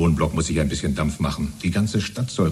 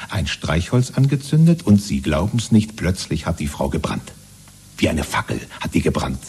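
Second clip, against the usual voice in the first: normal talking voice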